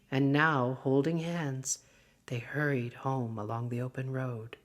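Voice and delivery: plain reading voice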